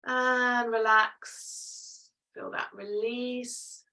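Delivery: very slow, calming guide; slow and soothing. Real, not acted. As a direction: draw each word out gently. Guiding release after held pose.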